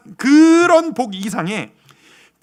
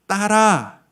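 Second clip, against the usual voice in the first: ordinary talk, nothing marked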